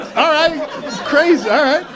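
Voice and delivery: laughing disbelief; stunned. Real, not acted. [name] reacting to the warning, laughing disbelief.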